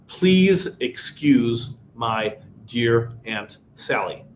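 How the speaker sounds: normal talking voice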